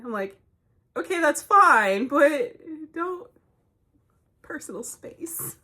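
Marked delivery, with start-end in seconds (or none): 1.0-3.3 high, loud, drawn-out mock patience; exasperated allowance. Shopper answering an apologetic shover, exasperated. Acted reply to excited shover. 4.4-5.6 slow, voiceless mock whisper; hushed plea. Shopper asking a shover for room, whispered.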